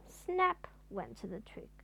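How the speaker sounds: ordinary delivery, nothing marked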